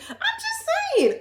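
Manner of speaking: very high, slow sing-song; sing-song sarcasm. Pretend. Sarcastic sign-off remark.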